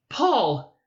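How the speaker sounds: high, loud alarmed call of his name. A narrator's reading. Mother waking to her son's outburst, alarmed cry.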